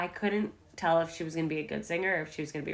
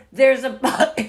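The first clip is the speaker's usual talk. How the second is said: higher, louder, gagging cry of alarm; imitating Tanya choking; 6 semitones higher, 11 dB louder, slower than usual. Imitating Tanya choking on the Vespa, loud and panicked. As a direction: choke it out in a panic. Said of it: "she's like".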